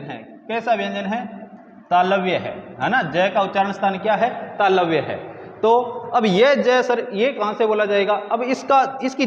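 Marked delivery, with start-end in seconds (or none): none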